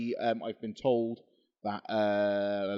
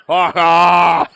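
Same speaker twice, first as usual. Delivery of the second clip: Mick Foley; 5 semitones higher, 16 dB louder than usual. Pretend. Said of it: "What's the worst you're gonna say?".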